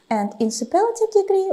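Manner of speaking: usual speaking voice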